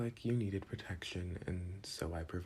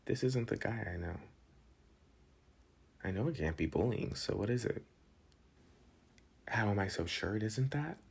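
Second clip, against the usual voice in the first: usual speaking voice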